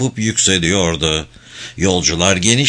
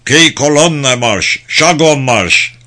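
slow, loud, echoing command; echoing muffled shout; 4 semitones higher, 4 dB louder, slower than usual. A narrator's reading. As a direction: bark the order across the platform. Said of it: "yankılanan boğuk bir sesle ... bağırıyor".

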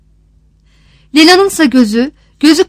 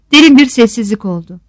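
ordinary delivery

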